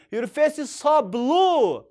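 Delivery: slow, high rising questions; slow concerned model. Shown as a demonstration. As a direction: worry about a friend. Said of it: "Or you can say".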